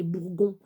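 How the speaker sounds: "bourgon" with a hard G, as in gare. Shown as a demonstration. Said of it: "Parce que sinon". (« bourgon » avec un G dur)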